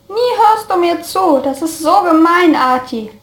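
whiny, high pouty complaint; pouting. A narrator's reading. Friend pouting at being ignored, whiny and high. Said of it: "zog dann eine Schnute".

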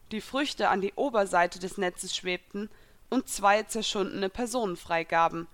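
plain reading voice

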